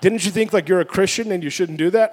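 mock-incredulous rebuke, rising at the end; scolding wife. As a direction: press the retold question harder. Repeats wife's scolding question more forcefully.

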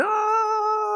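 short high mock scream of a scared passenger; imitated scream. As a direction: yelp it like a terrified flyer. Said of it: "she'd scream".

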